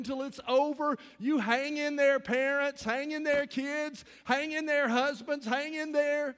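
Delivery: loud, high, rousing exhortation; preaching at full force to struggling parents, rousing; fervent exhortation. Acted. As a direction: lift the room, urging them on.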